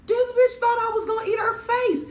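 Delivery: high, loud mock outrage; comic outrage at her friend; indignant outburst. Real, not acted. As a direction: belt the punchline incredulous. Punchline outburst.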